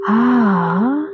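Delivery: drawn-out, relieved "ah"; relaxed sighing ah; sigh of letting go. Shown as a demonstration. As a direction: melt into a long, easy ah. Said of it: "allow this feeling of".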